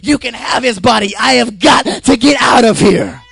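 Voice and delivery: loud, high-pitched sermon shout; full-force preaching. Acted. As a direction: preach it at the top of your voice.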